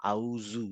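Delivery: আউযু said quietly with a buzzing z; whisper-soft teaching demo of আউযু. Shown as a demonstration. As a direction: isolate আউযু softly, stress the z. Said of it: "আমরা সাধারণত বলি আউযুবিল্লাহ".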